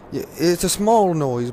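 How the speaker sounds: ordinary talk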